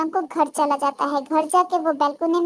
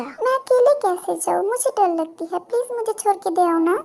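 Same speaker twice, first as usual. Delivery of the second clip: coaxing little plea, slightly raised; wheedling girl; 3 semitones higher than usual. Acted. Girl asks crush to drop her home, wheedling. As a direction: plead helplessly to be taken along.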